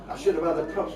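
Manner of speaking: normal talking voice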